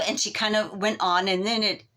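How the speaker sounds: usual speaking voice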